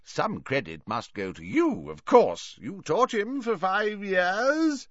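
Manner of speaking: swooping high professor, stretched endings; tipsy Slughorn, chummy. Acted. Merry host shares credit with his colleague, high and effusive. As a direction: flatter a colleague with tipsy cheer.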